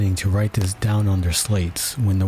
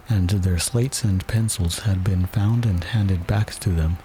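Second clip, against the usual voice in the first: ordinary delivery, nothing marked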